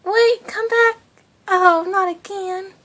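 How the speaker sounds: left-behind little guy. Retold or imitated. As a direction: call after them, small and forlorn. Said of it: "He's like".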